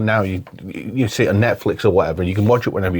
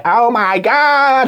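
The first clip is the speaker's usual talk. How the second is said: high, very slow drawn-out; mock Janice; 12 semitones higher, 3 dB louder, much slower than usual. Friend imitating Janice's catchphrase, drawn out. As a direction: stretch it like Janice.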